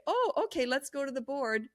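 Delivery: casual friendly leader voice; light breezy tone. Shown as a demonstration. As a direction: invite her to the board like nothing happened. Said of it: "And that's how you speak".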